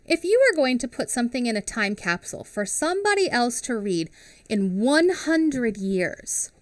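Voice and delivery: ordinary talk, nothing marked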